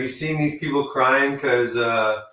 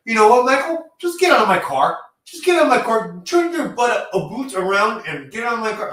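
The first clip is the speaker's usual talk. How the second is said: higher, louder mock angry eviction; imitating Kevin Owens; 8 semitones higher, 5 dB louder than usual. Mocking Kevin Owens ordering Michael Cole out, loud and high. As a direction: bark him out of the car. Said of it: "In the car with fucking Michael Cole".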